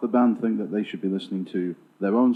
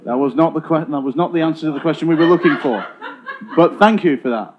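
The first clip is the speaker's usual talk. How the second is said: louder, laughter-tinged correction; amused loud rebuff; 3 semitones higher, 8 dB louder than usual. Real, not acted. Host rejecting the drummer's egg answer, amused and loud. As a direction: talk over the laughs, mock-official.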